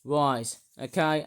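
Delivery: ordinary delivery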